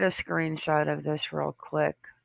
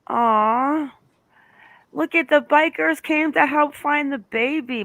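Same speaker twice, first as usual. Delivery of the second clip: a touched, excited lift, higher and brighter; touched excitement; 9 semitones higher, 4 dB louder than usual. Real, not acted. Host watching bikers join the search, touched and excited. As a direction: point the bikers out in real delight.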